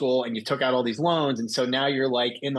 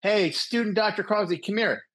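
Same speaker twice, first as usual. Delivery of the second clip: higher-pitched, lightly voiced attending; calling student over; 8 semitones higher than usual. Attending calls the student in to translate, lightly voiced summons.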